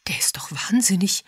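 husky half-whispered mutter to herself; whispery, exasperated aside. A narrator's reading. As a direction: mumble in disbelief. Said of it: "murmelte sie".

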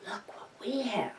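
soft unvoiced whisper to the possum. Real, not acted. Whispering to the possum about the treat. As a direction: hush it to the animal.

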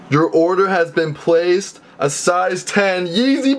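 high, slow, savoring each word; thrilled announcement. Real, not acted. Reading the order confirmation to viewers, thrilled. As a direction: announce the win slowly, pitch climbing.

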